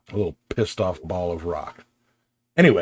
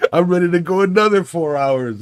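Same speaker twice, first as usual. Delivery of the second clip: high and loud, eager to keep playing; hyped-up boast; 11 semitones higher, 8 dB louder than usual. Still pumped at midnight, telling friends, loud eager boast. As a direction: sell it like you could go all night. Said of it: "It's like".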